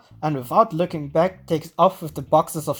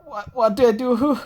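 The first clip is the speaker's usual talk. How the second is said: panicked; 7 semitones higher than usual. Acted. Senri panicking.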